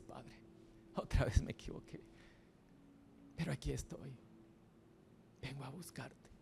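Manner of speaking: hushed, near-whispered confession; humble prayer. Acted. A sinner confessing to the Father, hushed prayer.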